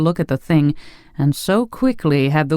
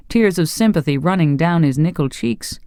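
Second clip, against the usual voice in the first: plain reading voice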